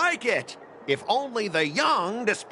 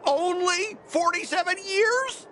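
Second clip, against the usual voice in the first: high, slow, stunned question; can't believe the wait is that long; shocked disbelief; 11 semitones higher, slower than usual. Acted. Scott reacting to the forty-seven year wait, stunned and high. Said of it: "Why so surprised?".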